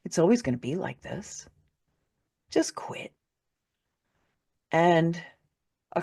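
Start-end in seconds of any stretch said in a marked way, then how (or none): 0.0-1.5 softer, hopeless voice telling her to give up; dark inner voice. Retold or imitated. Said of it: "And it said". 2.5-3.1 a hushed, hopeless whisper; whispered command. Retold or imitated. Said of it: "And it said".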